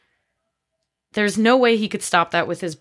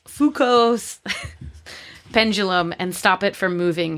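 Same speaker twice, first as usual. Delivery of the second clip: usual speaking voice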